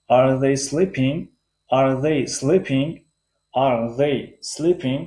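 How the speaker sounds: "Are they sleeping?" very slow, "sleeping" lifted; rising question tune. Shown as a demonstration. Teacher drills a yes/no question, slowly raising the last word. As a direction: raise the voice on "sleeping", word by careful word. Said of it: "sleeping بلند است".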